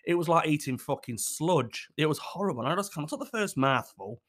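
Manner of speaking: ordinary talk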